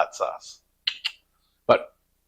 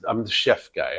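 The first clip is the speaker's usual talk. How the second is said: ordinary talk, nothing marked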